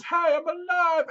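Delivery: very high, stretched-out cry; mock cheery call. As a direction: mimic a dad popping up in a put-on high voice. Dad suddenly appears, retold.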